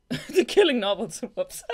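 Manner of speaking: real giggling fumble; laughing at a slip. Real, not acted. Laughs at misreading.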